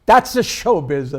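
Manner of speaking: slow, loud, shrugging "That's the showbiz."; old Italian widow punchline. Retold or imitated. Punchline of the Chiavelli joke, widow shrugging it off loudly. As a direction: land the widow's line big and slow. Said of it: "She says".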